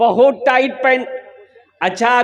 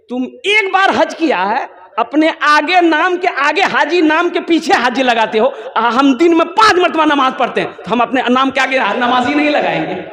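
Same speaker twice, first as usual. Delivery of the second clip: higher-pitched, insistent comeback; indignant retort; 4 semitones higher, 3 dB louder than usual. Acted. Namazi answers the haji about his title, indignant retort. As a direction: argue the point, speeding up towards the end.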